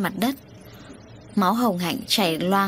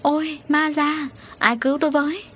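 terrified cry; 9 semitones higher than usual. A narrator's reading. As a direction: cry out in terror at a ghost. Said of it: "Hồng Hạnh hét lên".

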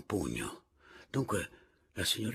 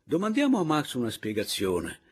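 loud, emphatic public address; pointed proposal; 4 semitones higher, 7 dB louder than usual. A narrator's reading. Narrator proposes questioning Max to the caporale and Papiano, pointed. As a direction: propose it firmly to the room.

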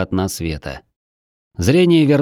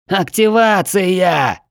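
high, slow gurgle through blood; choking on blood as he speaks; gurgling; 9 semitones higher, much slower than usual. Acted. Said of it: "пробулькал".

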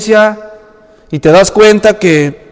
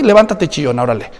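dismissive rebuke; 6 semitones lower, 4 dB quieter than usual. Retold or imitated. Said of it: "¿quieres que te diga qué mal hiciste?".

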